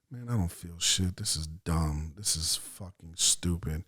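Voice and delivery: low, soft, fed-up grumble; retold private griping, voice sunk down; grumbling inner thought. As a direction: think it to yourself, unimpressed. Unimpressed thoughts while others meditate.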